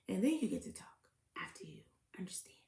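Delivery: trailing off into a whisper. Real, not acted. Host whispers a jab at the interviewer. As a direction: hush it as a sly aside.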